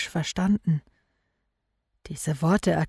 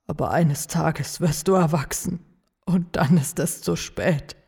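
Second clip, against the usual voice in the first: stern father. Acted. Weeping father voices dread.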